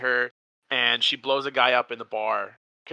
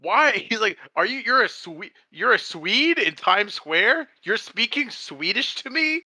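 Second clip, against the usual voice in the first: much higher and louder, stunned disbelief; astounded; 9 semitones higher, 4 dB louder, slower than usual. Retold or imitated. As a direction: shriek it like you can't believe a Swede is in Times Square. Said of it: "he's so astounded".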